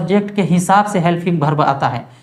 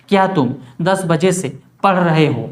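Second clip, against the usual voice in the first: ordinary delivery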